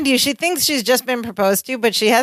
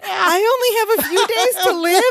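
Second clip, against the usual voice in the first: much higher-pitched, incredulous quote; shocked; 10 semitones higher than usual. Retold or imitated. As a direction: imitate a woman stunned by dreadful news. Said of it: "Marianne is shocked".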